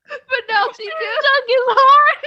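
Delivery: very high, loud tearful squeal; laugh-crying. Real, not acted. Daughter joking mid-apology to mom, laugh-crying. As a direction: wail it out through laughter and tears.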